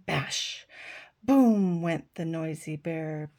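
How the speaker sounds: lively picture-book reading with voices for the animals; reading to children. A narrator's reading. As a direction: read it like a bedtime story for little ones.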